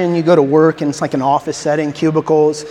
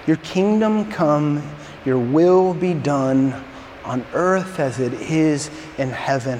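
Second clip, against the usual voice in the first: prayer recitation; 4 semitones lower, 6 dB quieter, slower than usual. Acted. Pastor reciting the Lord's Prayer.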